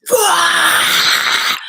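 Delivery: sudden loud high yell; explosive shout. Retold or imitated. Reliving her own anger outburst, loud high yell. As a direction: blurt it out in a burst of rage.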